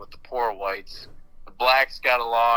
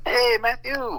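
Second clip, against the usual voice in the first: put-on higher-pitched Mexican grandpa accent; 13 semitones higher, slower than usual. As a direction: greet him like an old Mexican grandpa. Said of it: "What does he sound like?".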